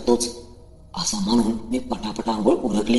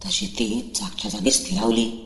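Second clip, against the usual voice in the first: plain reading voice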